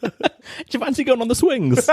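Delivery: high, laughing invitation; playful offer. Pretend. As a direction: tease it like a fun day out. Mock examiner invite.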